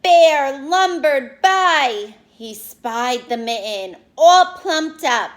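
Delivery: put-on slow, big read-aloud for kids; storybook reading. A narrator's reading. Teacher reading a picture book to her class, slow and big.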